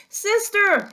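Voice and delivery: high, loud shout for her sister; shouted call. Acted. Baby calling for Eugenia, high and loud. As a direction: yell for sister in panic. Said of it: "shouted Baby".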